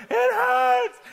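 high, loud whine of pain; whiny pain cry. Retold or imitated. Stung teen complaining on the beach, high loud whine. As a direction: wail it like a hurt kid. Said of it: "I'm sitting there going".

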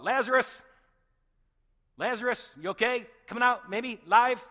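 loud, high call into the tomb. Retold or imitated. Mourners calling into the tomb, loud and high. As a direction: shout his name into the cave. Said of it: "they'd call out".

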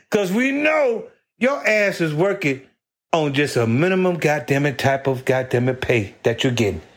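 ordinary delivery

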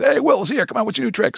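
high, cheery bar greeting; calling out. Retold or imitated. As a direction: hail him like an old buddy.

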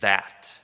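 "that" dropped low and set apart; emphasized THAT. Shown as a demonstration. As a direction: land "that" alone, low and firm. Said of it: "I want to emphasize the word".

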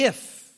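"if" punched loud; stressed if. Shown as a demonstration. Preacher driving home the temptation to the church, stressing "if". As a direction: hit "if" as the trap. Climax on the key word.

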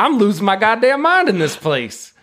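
high-pitched, hammed-up exasperation; exasperated outburst. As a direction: marshal at the end of his rope. Marshal losing patience, acted.

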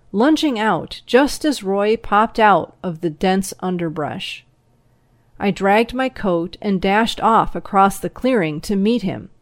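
plain reading voice

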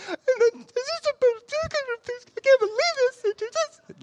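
very high, squeaky mock whimper; imitating crying child. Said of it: "I heard her crying in the other room".

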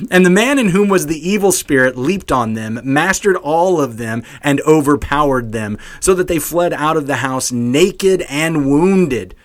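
loud, lifted storytelling that settles toward the end; dramatic scripture reading. Acted. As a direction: read the action big, as if to kids.

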